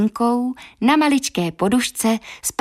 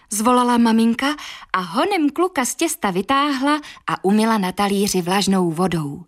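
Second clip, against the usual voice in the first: usual speaking voice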